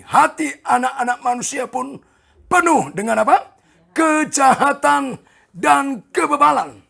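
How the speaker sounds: loud, high, slowed proclamation; emphatic scripture reading. Real, not acted. Preacher reads verse out for emphasis. (membaca ayat dengan lantang dan tegas)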